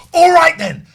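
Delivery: loud, high furious "all right, then"; angry shout. Retold or imitated. Furious customer shouting at the phone agent. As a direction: bark it out in rage. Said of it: "I'm getting angry and I'm shouting".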